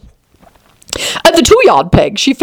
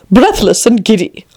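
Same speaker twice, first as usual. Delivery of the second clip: ordinary delivery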